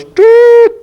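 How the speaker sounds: high loud mock whistle blast; imitating the umpire's whistle; whistle toot. Umpire blows whistle sound.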